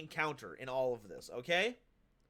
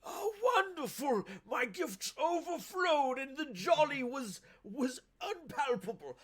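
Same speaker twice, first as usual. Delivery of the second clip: put-on old man voice; 9 semitones higher, 3 dB louder than usual. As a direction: play a jolly old man greeting guests. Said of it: "I'm gonna stop doing my old man voice".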